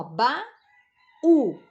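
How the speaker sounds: teacher stressing the separated u in baú; syllable by syllable. Shown as a demonstration. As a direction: split ba and u, hitting the u. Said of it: "nessa separação, ó".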